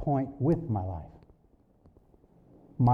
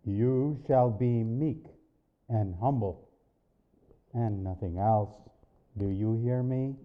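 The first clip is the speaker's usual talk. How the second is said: firm voice of God; 4 semitones lower than usual. Retold or imitated. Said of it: "firm and penetrating".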